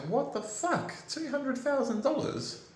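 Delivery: can't believe the number, voice shoots up; stunned disbelief. Retold or imitated. Reading the budget letter to viewers, shocked high disbelief. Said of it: "And I'm just like".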